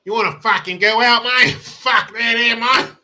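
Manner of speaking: hammed-up Australian accent; mock Australian accent. Mocking an Aussie bloke picking a fight in an exaggerated accent. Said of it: "Australian accent".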